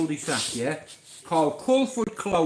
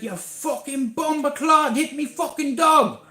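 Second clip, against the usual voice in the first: retold angry shout; 5 semitones higher than usual. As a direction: imitate an enraged neighbour yelling. Enraged neighbour confronts him over dog.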